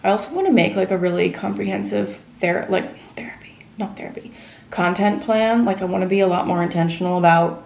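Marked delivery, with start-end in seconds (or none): 3.1-4.3 whispered aside to herself; whispering. Real, not acted. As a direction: drop to a whisper mid-sentence. Whispered aside self-correction.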